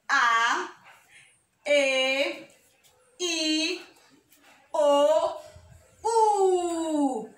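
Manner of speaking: each vowel held and set apart; drawn-out vowels. Shown as a demonstration. Teacher reciting vowels to young children, drawn out. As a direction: sound out the vowels one by one.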